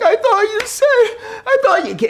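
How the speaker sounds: high whiny half-laughing wail; mock sob like an upset child; imitating crying kid. Little boy crying he's out of the game, mock whine.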